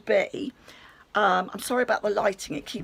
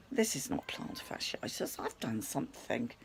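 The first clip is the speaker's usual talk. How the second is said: breathy whisper, much softer, dropping low; whispered thought; 7 semitones lower, 12 dB quieter than usual. Retold or imitated. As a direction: whisper it to yourself in dread. Inner dread about her ankle.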